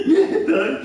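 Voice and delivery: can't keep it together on the line; giggling through the quote; laughing. Real, not acted.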